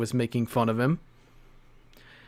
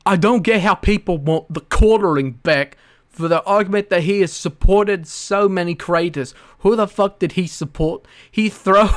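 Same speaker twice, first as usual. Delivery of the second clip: loud, higher mock British accent reading a tweet aloud; 5 semitones higher, 4 dB louder than usual. Streamer reads a viewer's comment in a mock British accent. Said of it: "have to read this in the British voice".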